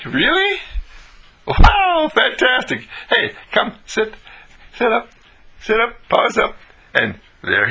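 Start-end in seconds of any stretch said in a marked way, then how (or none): 0.1-2.9 high squeal of mock astonishment; amazed delight. Owner told his dog can sit up, amazed delight. 3.1-6.6 high-pitched, short excited commands; coaxing a dog. Acted. Owner gets dog to sit up, coaxing.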